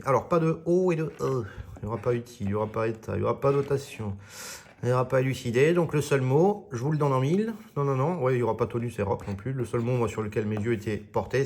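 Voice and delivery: ordinary talk, nothing marked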